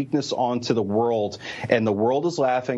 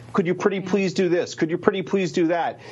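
playing helpless; 7 semitones higher than usual. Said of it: "stark difference from the former president".

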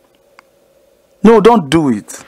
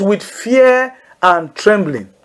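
slow, weighted "fear and trembling"; slow emphasis; 2 semitones higher, much slower than usual. Shown as a demonstration. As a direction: lean slowly on "fear", every word weighed. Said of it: "Say that with me".

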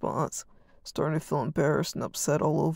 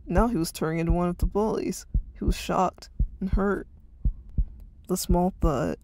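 usual speaking voice

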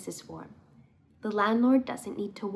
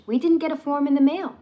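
ordinary delivery

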